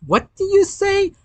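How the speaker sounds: high-pitched, stunned question; shocked question. A narrator's reading. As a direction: blurt it out in disbelief, pitch jumping up. Shocked characters question in story.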